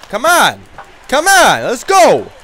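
high, loud challenge; taunting shout. Pretend. Enforcer daring the opponents, loud taunting. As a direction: call them out to fight.

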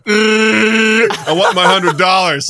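loud, high, drawn-out Chewbacca-style yell; Chewbacca impression caller. Prank caller after the contest prize, mock Chewbacca roar. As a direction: imitate a caller roaring slowly for prize money. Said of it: "this guy's going".

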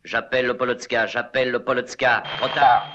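desperate radio call. Acted. Desperate radio calls, plane failing.

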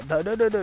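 loud quick "डा डा डा" gunshot sounds; spray mouth gunfire at the enemy; imitated gunfire. Real, not acted. Gamer firing at an enemy, loud mouth gunfire.

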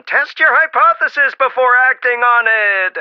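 playful mock yell of frustration. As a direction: shout it like scolding a TV show. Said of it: "I find myself yelling".

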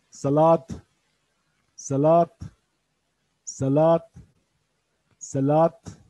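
Salat said loudly with a rising tune; loud clear demo. Shown as a demonstration. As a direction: call out Salat for students to repeat. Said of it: "saladı kullanıyoruz". (Salat kelimesini yüksek sesle, yükselen tonla söyle)

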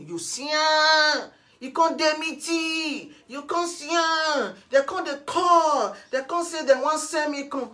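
high, loud, drawn-out phrase endings; heated chanting outburst; angry rant. Real, not acted. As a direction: shout out each grievance, stretching the last word. Angrily mimicking what people reported.

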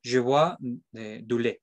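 "je bois du lait" in French accent; French sentence demo; French phrase. Shown as a demonstration. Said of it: "en francés sería algo así como".

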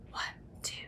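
usual speaking voice

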